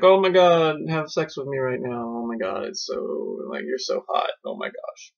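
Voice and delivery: hammed-up swoon, trailing into hushed voice; mock swooning woman. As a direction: imitate a woman overcome with lust, fading away. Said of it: "then she's like".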